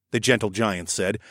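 plain reading voice

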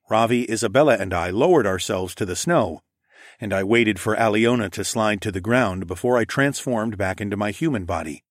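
ordinary delivery

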